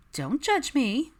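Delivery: high, slow mock-defensive plea; playful plea. Pregnant Daphne begging her friends not to judge, drawn out. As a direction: drag it out, teasing her friends.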